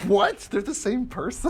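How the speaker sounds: high-pitched, rising astonished question; mock disbelief. Reliving finding out about Hannah Montana, mock disbelief. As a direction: squeak it out as if mind blown.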